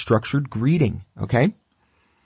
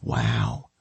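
much lower, hushed dog saying wow; a dog gasping in quiet awe; awed dog voice; 6 dB quieter than usual. Retold or imitated. Trainer voicing a dog awed by its owner, low hushed wow. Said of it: "And they're like".

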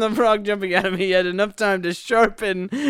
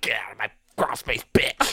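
aggressive spider; 10 semitones lower, 6 dB quieter than usual. Pretend. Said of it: "Why is he so aggressive?".

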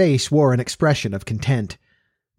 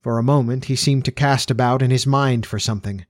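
ordinary delivery, nothing marked

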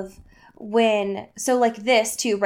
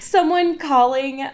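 ordinary delivery

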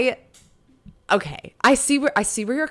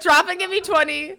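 laughing coach order, higher; mock drill sergeant; 7 semitones higher than usual. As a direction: bark it like a gym coach. Ordering push-ups, laughing.

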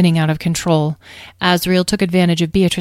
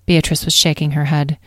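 plain reading voice

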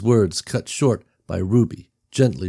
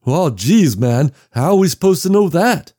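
higher, louder frustrated cry; he can't believe it; exasperated outburst; 9 semitones higher, 5 dB louder than usual. Acted. Walt exasperated in story.

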